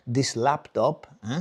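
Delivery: put-on slow, thick Spanish-accented complaint. Spanish father complaining to son about laptop, accented. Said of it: "He goes, uh".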